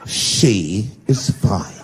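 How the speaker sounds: very slow, low, drawn-out haughty reply; indignant correction. Retold or imitated. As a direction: drag each word out low and offended. Offended friends correcting him.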